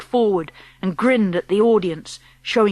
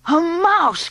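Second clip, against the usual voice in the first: excited cry; 9 semitones higher than usual. Acted. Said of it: "cried the witches".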